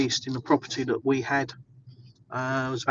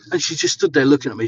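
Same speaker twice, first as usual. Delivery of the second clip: usual speaking voice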